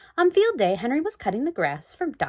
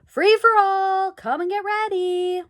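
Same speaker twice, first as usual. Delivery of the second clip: loud, slow call across the field; announcer calling the runners to the line; 11 semitones higher, 6 dB louder, slower than usual. A narrator's reading. Race official calling out to the runners, loud and drawn out. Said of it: "And then a man called".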